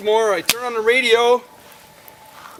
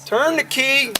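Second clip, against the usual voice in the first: usual speaking voice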